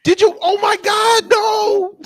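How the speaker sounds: high, loud disbelieving yell; shocked shout. Real, not acted. Streamer shouting in disbelief at the witness's admission. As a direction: cry out in horror at what she admitted.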